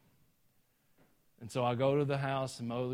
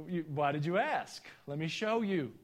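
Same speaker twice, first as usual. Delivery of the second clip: put-on louder, cocky teenage boast; 5 semitones higher, 4 dB louder than usual. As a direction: show off like a reckless teen. Cocky teen boasting about truck.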